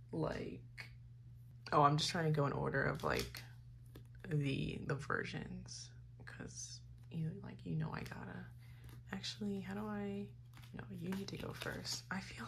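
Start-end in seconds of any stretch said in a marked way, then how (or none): 6.2-12.4 whispering to self. Real, not acted. Muttering while sorting.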